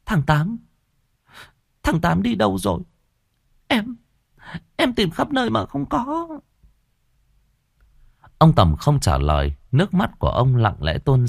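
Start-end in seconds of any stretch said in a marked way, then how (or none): 0.1-6.3 high, soft tearful whimper; whimpering sob. A narrator's reading. Young woman whimpering to the father about the missing boy. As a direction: sob out the question, voice trembling. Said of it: "cứ thế mà mếu máo".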